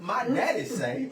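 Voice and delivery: slow, drawn-out movie catchphrase delivery; Waterboy. Retold or imitated. Son quoting his daddy like the film, slow mock drawl. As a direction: lightly imitate a slow-talking mama's boy. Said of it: "or like on Waterboy".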